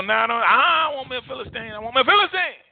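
high-pitched, whiny mock protest; insistent son. Son telling his parents whom he wants, insistent.

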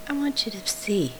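ordinary talk

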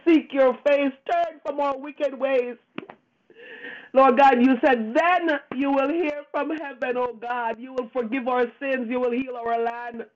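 ordinary talk

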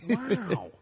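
high, loud drawn-out wow; breathy amazed wow; Owen Wilson wow. Pretend. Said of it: "Owen Wilson".

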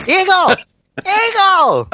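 high, loud hammed-up shout; shouted cry. Reader bursts out as Troy comes back, high shout. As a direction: yell it out twice, far up in pitch. Character cry within narrated script.

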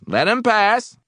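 high, loud call to the brothers; loud call to a meeting. A narrator's reading. Union man letting a visitor go, calling out loudly.

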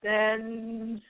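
slow, drawn-out auction call; auctioneer. Pretend. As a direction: close the sale like an auctioneer. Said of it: "Are you an auctioneer?".

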